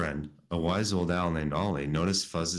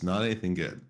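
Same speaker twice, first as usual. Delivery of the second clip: usual speaking voice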